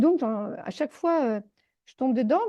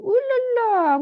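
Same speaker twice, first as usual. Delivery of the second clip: high alarmed exclamation; 12 semitones higher, 3 dB louder, much slower than usual. Retold or imitated. Healer finding something behind the door, alarmed and high. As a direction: react sharply to what you sense.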